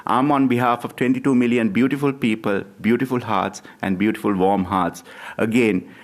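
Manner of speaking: ordinary delivery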